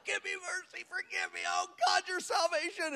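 imitated high-pitched pleading; crying out. Pastor imitates the man begging God, crying out. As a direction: mimic a thin, high wail for mercy. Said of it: "He's crying out loud".

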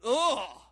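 heavy, loud "ugh"; overwhelmed retold groan. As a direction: groan it out as if hit by the sight. Imitating the impact of the scene.

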